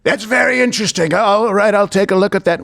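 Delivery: lightly voiced imitation of the president; Trump impression. Host mocking the president's brush-off, lightly imitated.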